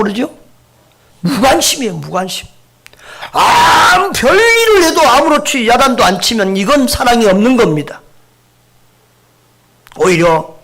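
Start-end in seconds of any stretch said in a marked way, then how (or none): 3.3-8.0 loud, high crying-out sermon line; preaching shout. Real, not acted. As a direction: cry it out from the pulpit. Preacher stresses his point. (설교하며 높이 외치는 목소리)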